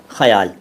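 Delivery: ख्याल as two syllables, 'kha-yaal'; correct pronunciation. Shown as a demonstration. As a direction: repeat ख्याल carefully with full throaty kh. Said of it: "वो सही श-, शब्द है ख्याल". (सही उच्चारण, ख्याल को 'ख़-याल')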